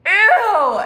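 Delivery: a loud, high, disgusted "Ew!". Real, not acted. Reacting to an ex's nonsense with a loud, high, disgusted squeal.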